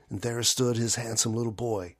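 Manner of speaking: usual speaking voice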